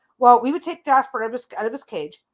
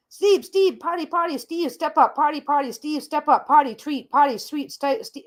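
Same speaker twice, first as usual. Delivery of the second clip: higher, frantic parrot chatter; excited parrot; 4 semitones higher than usual. Retold or imitated. The parrot telling her about his morning, frantic and high. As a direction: rattle off the bird's words like an excited parrot. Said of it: "And he's freaking out".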